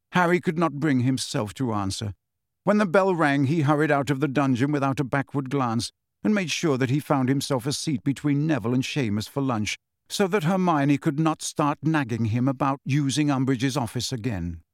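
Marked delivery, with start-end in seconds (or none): none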